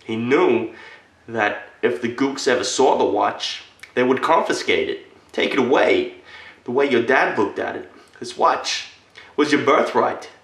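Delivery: impassioned, fiercely proud retelling; indignant fervor. Acted. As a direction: build in heat and pitch to an emphatic peak. Heated account of hiding the watch.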